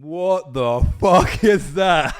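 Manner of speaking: slow, loud, high shout of disbelief; outraged shout. Retold or imitated. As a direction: bellow it out in slow shock. Retold outburst at a photo.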